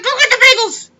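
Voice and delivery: very high, loud nagging wife voice; wife yelling at her husband to fetch bagels; shrill nagging wife. Retold or imitated. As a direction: screech the order like a nagging wife. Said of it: "She said".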